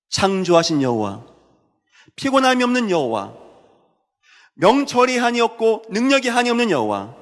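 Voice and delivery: high, ringing preaching voice; scripture declamation. Acted. Preacher declaims Isaiah to the congregation, impassioned preaching. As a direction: proclaim each phrase to the congregation.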